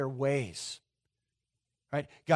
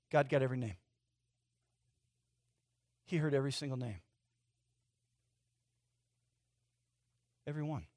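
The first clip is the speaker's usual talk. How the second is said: hushed; 3 semitones lower, 7 dB quieter, faster than usual. Real, not acted. Sermon drops to hushed point.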